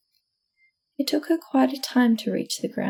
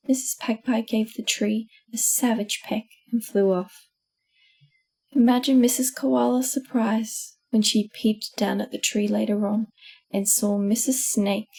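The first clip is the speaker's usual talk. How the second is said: ordinary delivery, nothing marked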